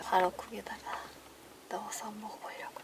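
soft unvoiced whisper. Real, not acted. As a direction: whisper it so nobody wakes. Quiet late-night eating vlog.

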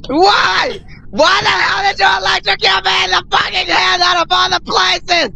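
normal talking voice

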